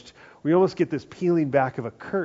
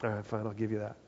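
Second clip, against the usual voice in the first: grudging concession; 4 semitones lower, 11 dB quieter, faster than usual. Retold or imitated. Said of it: "he's like".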